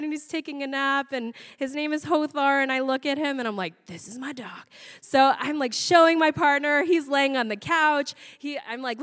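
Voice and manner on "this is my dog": very soft, dropped voice; hushed certainty. Retold or imitated. Woman looks at puppy photo, hushed certainty. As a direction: murmur it as a solemn claim.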